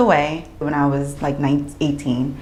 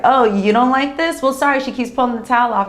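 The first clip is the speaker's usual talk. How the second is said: lightly acted defiance, pitched higher; mock-apologetic retort; 6 semitones higher, faster than usual. Breastfeeding mother to disapproving onlookers, mildly defiant. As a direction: toss back a quick, higher mock apology.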